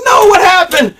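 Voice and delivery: high, loud pleading disbelief; shocked fan. Retold or imitated. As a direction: cry out in dismay at the blackout. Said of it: "As a fan, I'm like".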